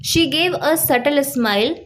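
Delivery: slow, clear example line; slow example sentence. Shown as a demonstration. Teacher reading the example to students, slow and clear. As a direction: read each word slowly for learners. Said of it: "जैसे".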